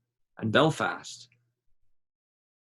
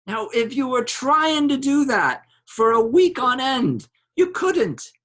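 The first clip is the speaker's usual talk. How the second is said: mock high voice; 12 semitones higher, 4 dB louder than usual. Bloom mocks a high-voiced woman.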